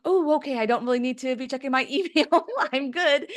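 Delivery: high, nervous retreat, laughing; mock-scared backing off; imitating the husband spooked by the computer. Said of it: "my husband was like".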